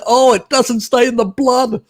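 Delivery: high, mocking sing-song quote; mocking researchers. Scoffing at researchers who argue, mocking high quote. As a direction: parody the researchers' claim. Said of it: "They say".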